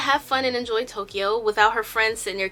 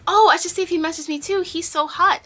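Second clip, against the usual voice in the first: high, louder mock-eager gush; gushing crush; 8 semitones higher, 4 dB louder than usual. Yena hopes Young Bae messaged her too, gushing. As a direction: imitate an eager friend swooning. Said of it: "She's always emphasizing how hot".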